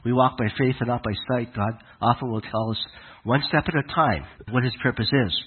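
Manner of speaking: ordinary talk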